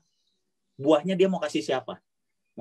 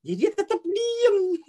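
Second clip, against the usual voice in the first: much higher, slow, breaking into laughter; laughing; slower than usual. Real, not acted. Teacher laughs to the kids about the silent tree, laughing.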